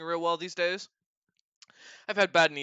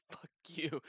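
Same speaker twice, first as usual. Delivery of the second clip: muttered mock curse; 13 dB quieter than usual. Retold curse under breath, laughing.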